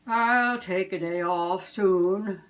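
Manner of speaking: a busy Santa promising himself a break. Acted. Santa to himself, slow jolly voice.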